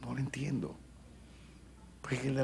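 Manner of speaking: soft, breathless whisper. Acted. Preacher whispering his confusion to the congregation. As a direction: whisper it in disbelief.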